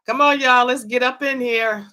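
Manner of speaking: loud, high call to the viewers. Real, not acted. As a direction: call everyone in like a host. Calling viewers into the live.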